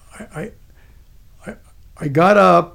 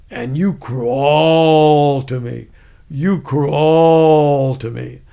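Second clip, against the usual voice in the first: loud drill order with a long drawn-out "crawl"; imitated sergeant's drawn-out command; real loud; 6 dB louder, much slower than usual. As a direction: stretch "crawl" into a slow bellow. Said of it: "he said it real loud".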